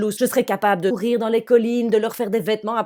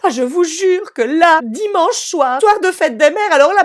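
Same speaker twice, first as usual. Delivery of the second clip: high, loud, nearly crying rant; loud distressed telling; 8 semitones higher, 11 dB louder than usual. Real, not acted. Mother telling the audience about the tennis disaster, loud and distressed. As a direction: tell the disaster near tears, loud.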